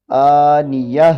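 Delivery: "آنیہ" slow and loud, final ta turned to h; pausal form. Shown as a demonstration. Teacher repeats the changed round ta, "آنیہ" ending in h. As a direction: hold the "آ", close "آنیہ" on h. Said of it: "گول تا کو ہا سے بدل دیا".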